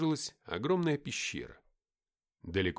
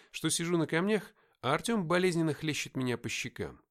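usual speaking voice

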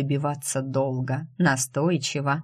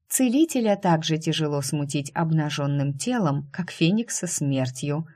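ordinary delivery

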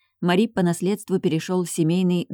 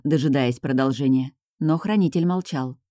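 ordinary delivery, nothing marked

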